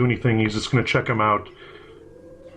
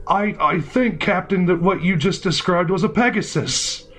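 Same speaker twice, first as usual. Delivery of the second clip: higher, hesitant soldier voice; timid soldier; 6 semitones higher than usual. Acted. Timid soldier correcting his captain, higher hesitant voice. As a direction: correct the boss nervously.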